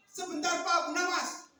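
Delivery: high, loud hail; acted shout for attention; calling out. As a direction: stop a passer-by urgently. Three men hail Abu Nawas.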